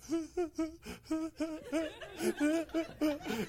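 high, soft hiccuping sobs; hammed-up kid's stuttering cry; imitated child sobbing. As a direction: whimper in short mock sobs.

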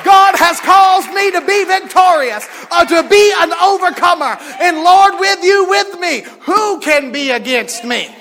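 loud, high proclaiming; full-force preaching. Real, not acted. The preacher declaring victory to the congregation, loud. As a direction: preach it to the rafters.

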